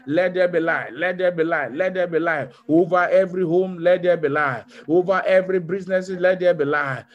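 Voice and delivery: rising chanted call and response; fervent declaration. Acted. Pastor declaring light over homes, rising fervor. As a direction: build each line as a proclamation.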